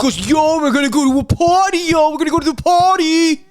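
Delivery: loud high party bro voice; Shane party impression. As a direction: hype party bro, yelling yo. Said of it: "That's my Shane party impression".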